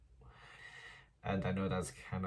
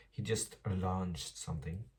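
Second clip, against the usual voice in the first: ordinary talk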